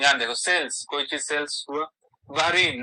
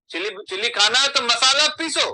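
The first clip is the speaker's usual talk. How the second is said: higher and louder, bossy mock wife; mock scolding wife; 8 semitones higher, 8 dB louder than usual. Wife teases husband who wants chilli chicken. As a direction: play the wife barking orders in jest. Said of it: "तब भाभी बोली".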